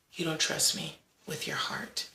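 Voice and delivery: hushed, almost a whisper; soft whisper of reproach. Retold or imitated. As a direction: breathe it gently like a secret. God speaking tenderly in prayer.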